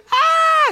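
very high-pitched sudden shriek; piercing mock demon scream; acted scream. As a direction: scream out like someone possessed. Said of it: "starts screaming and yells this stuff".

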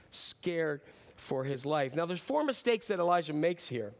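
normal talking voice

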